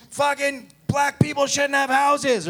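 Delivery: high, slow hammed-up rant; mock ranting pundit. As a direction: parody a TV yeller.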